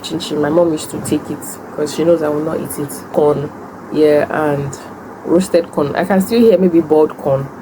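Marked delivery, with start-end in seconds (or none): none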